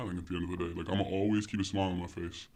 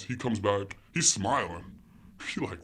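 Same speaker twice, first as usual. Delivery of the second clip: ordinary talk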